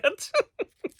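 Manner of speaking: bursting into a high laugh. Real, not acted. Laughing at own story.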